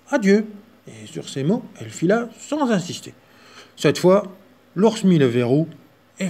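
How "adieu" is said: little mouse goodbye. Acted.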